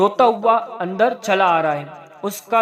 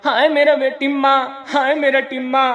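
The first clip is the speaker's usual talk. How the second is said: restless wailing; 6 semitones higher than usual. Acted. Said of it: "नसीबुन बेताब होकर".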